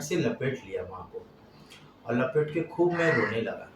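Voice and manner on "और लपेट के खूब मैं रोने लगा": usual speaking voice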